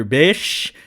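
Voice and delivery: usual speaking voice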